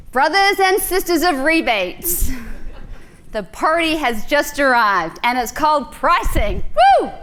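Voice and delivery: loud, hammed-up Southern US preacher voice, whooping at the end; a Kiwi throwing down Southern US charm at full volume; mock Southern US accent. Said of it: "this little Kiwi's gonna try and throw down a Southern charm".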